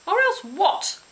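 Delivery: high, loud challenge; defiant shout. Acted. Girl daring the witch, high defiant shout. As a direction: dare her to say it.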